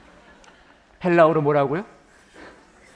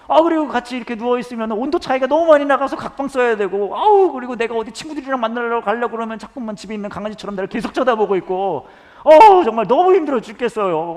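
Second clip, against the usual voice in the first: much higher and louder hammed-up wife, laughing in places; nagging wife rant; 9 semitones higher, 10 dB louder than usual. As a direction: gush complaints about the husband like a fed-up ajumma. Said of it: "뭘 뭐라고 하시냐면".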